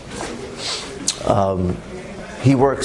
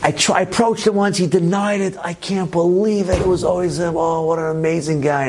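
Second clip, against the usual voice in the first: high, strained voice imitating a man in tears; retold weeping, pitched high; tearful outcry; 6 semitones higher than usual. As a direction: act out breaking down as a suspicion proves true. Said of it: "Shlomi started crying. He goes".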